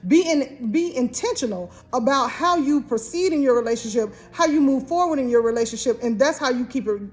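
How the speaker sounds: ordinary delivery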